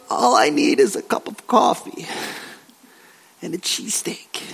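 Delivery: hushed wish, sinking to a whisper; weary wishing. Acted. Preacher as cold, wet Paul wishing for comfort, hushed. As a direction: trail down into a longing whisper.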